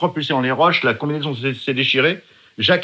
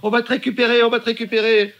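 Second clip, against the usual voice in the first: much higher call to come back; shouting over wind; 8 semitones higher than usual. Retold or imitated. Valérie calling him back over wind, higher mock shout. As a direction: plead with him to stop, over the waves. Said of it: "Valérie me crie très, très fort".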